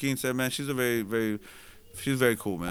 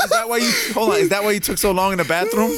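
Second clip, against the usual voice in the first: laughing quote; 7 semitones higher, 4 dB louder than usual. Retold or imitated. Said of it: "she was like".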